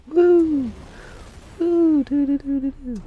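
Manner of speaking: very high, loud "woo" whoops fading into do-do-dos; magic sound effect. Pretend. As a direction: make a squealing magical whoosh with your voice. Imitates magic as number moves.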